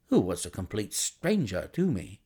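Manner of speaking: ordinary delivery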